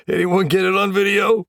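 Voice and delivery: high, loud shout-out; calling to the room. A narrator's reading. Teammates gather after the prank, loud high call-out. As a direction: pitch it up and call to everyone.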